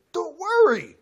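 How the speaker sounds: emphatic reassurance. Acted. Preacher reassures bad news listeners.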